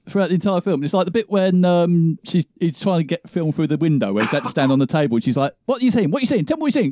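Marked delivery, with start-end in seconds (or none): 5.7-6.9 higher-pitched, insistent mimicry; mock pestering. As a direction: imitate an annoying presenter demanding answers. Imitates pestering, annoying presenter.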